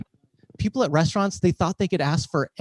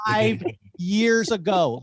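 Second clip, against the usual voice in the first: slow, higher, stretched-out indignant repeat; giving attitude; 6 semitones higher, much slower than usual. Retold or imitated. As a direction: drag out every word to make the point. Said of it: "then I gave him attitude".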